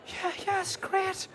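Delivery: high, half-laughing forced agreement; mock enthusiasm. Husband humouring his wife about the England tops, high and laughing. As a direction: fake delight through laughter. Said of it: "I go".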